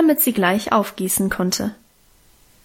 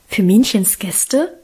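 slow, mildly astonished question; slower than usual. A narrator's reading. Child reacts to the plan for welcome music, astonished. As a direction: ask it slowly in mild amazement. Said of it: "fragte ich erstaunt".

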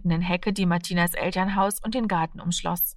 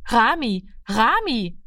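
higher, mildly raised name calls; calling after someone; 4 semitones higher, slower than usual. A narrator's reading. Martina to Rami as he runs away, calling after him. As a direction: call the name twice after someone running off. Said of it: "rief sie ihm nach".

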